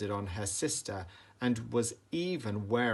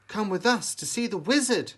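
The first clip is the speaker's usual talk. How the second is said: Dorothy suggesting; 9 semitones higher, 5 dB louder than usual. A narrator's reading. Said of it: "suggested Dorothy".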